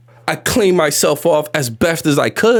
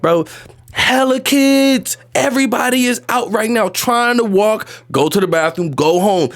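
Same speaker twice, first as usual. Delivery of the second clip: ordinary talk, nothing marked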